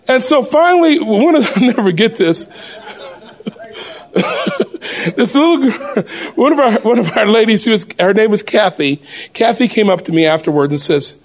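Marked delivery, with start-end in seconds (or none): 4.1-5.1 breathless high laugh. Real, not acted. Laughing at own story.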